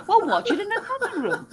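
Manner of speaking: high mock retort, breaking into a laugh; husband snapping back at his wife, imitated high with laughter; snappy husband. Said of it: "And him going".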